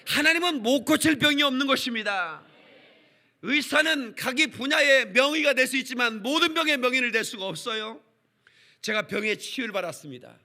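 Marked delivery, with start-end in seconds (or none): 0.1-8.0 high, loud preaching at full force; fiery preaching. Acted. Pastor preaching loudly to the congregation. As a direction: preach it to the back row.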